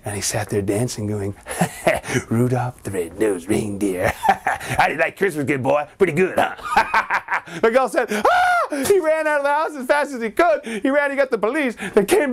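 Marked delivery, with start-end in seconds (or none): 2.3-6.6 creepy doll voice. Acted. Evil doll taunts the boy. 8.1-8.8 very high scream; very high terrified scream. Acted. Boy screams and runs.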